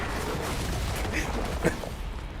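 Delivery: breathless, mostly voiceless laughter; real laughing fit. Real, not acted. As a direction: crack up and lose the words. Cracks up attempting the accent.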